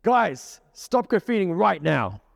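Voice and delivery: put-on stern police officer ordering kids; firm command. Shown as a demonstration. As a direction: order them to stop like a real cop. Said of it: "let's just say I did it again".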